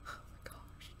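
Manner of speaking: whispered. Real, not acted. Fearing a ban after naming Trump.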